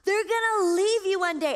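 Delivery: high-pitched hollered taunt, imitating strangers; retold yelling. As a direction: imitate someone yelling from another boat. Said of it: "laughing and yelling at us".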